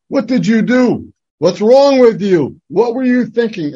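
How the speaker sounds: high, loud shocked scolding; scolding adults. Retold or imitated. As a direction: berate the kid as the angry grown-ups. Adults scolding child after accident.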